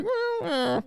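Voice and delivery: high, soft evasive "mm"; noncommittal hum. Retold or imitated. Jupiter doesn't really answer O J, high evasive humming. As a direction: hum vaguely to dodge answering. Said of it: "he's just kinda like".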